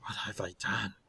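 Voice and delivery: very soft, stunned murmur; hushed horror. Acted. Werewolf seeing blood on his hands, hushed and horrified. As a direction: say it under the breath, appalled. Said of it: "and Plim says".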